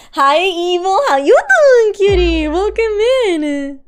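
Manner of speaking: high, loud sugary welcome; high cutesy voice welcoming a viewer; cutesy high greeting. Acted. Greeting chatter in put-on voice.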